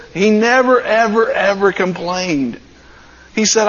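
ordinary talk, nothing marked

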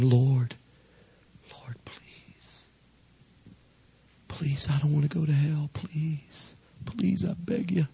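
hushed, desperate begging; desperate pleading. Acted. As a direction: beg under your breath, barely voiced at first. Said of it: "there's a desperation".

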